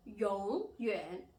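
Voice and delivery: 永远 drawn out with a dip and rise in tone; teacher modelling 永远, tones swooping slowly; carefully intoned Mandarin 永远; third-tone demo. Shown as a demonstration. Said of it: "symbol of the third tone".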